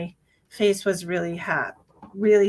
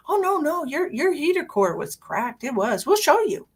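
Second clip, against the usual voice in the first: mock mechanic; 8 semitones higher than usual. Voicing the mechanic's protest.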